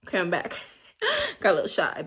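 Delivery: usual speaking voice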